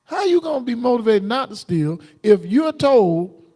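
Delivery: normal talking voice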